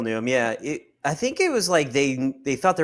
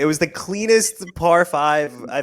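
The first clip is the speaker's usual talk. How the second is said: higher, louder, slowed plea; mock pleading; 3 semitones higher, 5 dB louder than usual. Golfer pleading to keep his clean par, hammed-up. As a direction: beg them to let you keep it.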